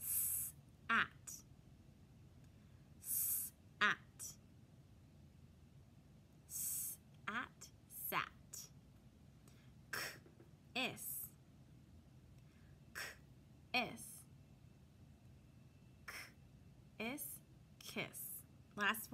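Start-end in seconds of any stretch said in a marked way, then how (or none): 0.0-7.7 "S-at" whispered, split in two; whispered sounding out. Shown as a demonstration. 9.9-17.2 "K-is" whispered, split in two; whispered sounding out. Shown as a demonstration.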